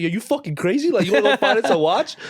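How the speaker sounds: put-on a father laughing off his teenage son's request; mocking dad. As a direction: play the dad, laughing in disbelief. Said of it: "He's like".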